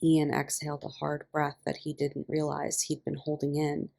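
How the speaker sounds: ordinary talk, nothing marked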